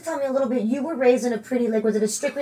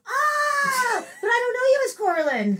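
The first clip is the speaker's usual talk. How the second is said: high, fast mock whiny protest; imitating Dino; 13 semitones higher than usual. Dino texting back that he doesn't know her, high mock protest. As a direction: squeal it out like a baffled friend. Dino's reply retold in text.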